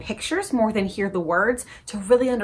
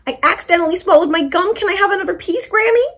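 put-on higher, quick childlike asking; little pig pleading; 10 semitones higher, 6 dB louder, faster than usual. Reuben asking Grammy for more gum, high and quick. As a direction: rush it like a kid who wants more.

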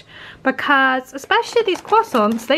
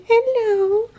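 much higher sing-song hello; cooing hello; 10 semitones higher than usual. Real, not acted. Owner greeting her sleepy dog, high cooing hello.

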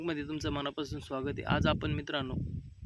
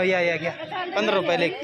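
vendor's call; 4 semitones higher, 6 dB louder than usual. Shown as a demonstration. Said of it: "एक गिऱ्हाईक बोलव म्हणा".

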